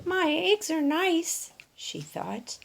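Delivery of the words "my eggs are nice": high, slow hen voice, a touch deflated; a little hen trying to stay proud; high, slow, slightly sad hen voice; Dora the hen, wistful. Acted. Dora's thoughts, less excited.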